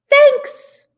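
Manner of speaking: high, loud squeaked thanks; a tiny squeaky bear thanks. Acted. Bear character voice.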